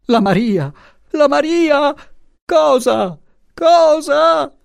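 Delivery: very high, frantic screaming; panicked shrieks, climbing in pitch; crazed cry. A narrator's reading. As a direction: cry out like a madwoman. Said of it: "Ella gridò come pazza".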